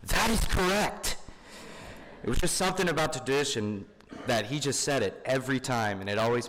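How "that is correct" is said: much higher and slow, imitating grandfather; grandpa's catchphrase, emphatic and drawn out. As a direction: pronounce it like a proud verdict. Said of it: "he'd always respond with the most emphatic".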